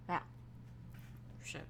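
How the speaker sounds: ordinary delivery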